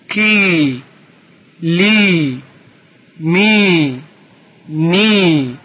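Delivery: every "ی" stretched into a long, sustained ee; one letter at a time, holding the ee in "ی"; drawn-out long vowel. Shown as a demonstration. Teacher showing stretched sounds to pupils, drawn-out ee. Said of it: "اینها باید با صدای کشیده خونده بشه".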